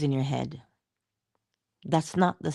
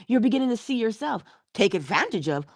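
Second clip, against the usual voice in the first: normal talking voice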